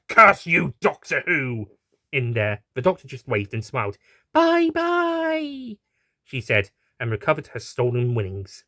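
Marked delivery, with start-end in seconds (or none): 0.1-1.7 higher, louder cartoon villain curse; villain's curse. A narrator's reading. Arrested horse cursing the doctor, louder villain yell. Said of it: "yelling and cursing". 4.3-5.8 high sing-song taunting bye-bye; cheeky bye-bye. A narrator's reading. Doctor waving off the arrested horse, high sing-song.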